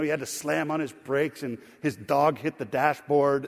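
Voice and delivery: usual speaking voice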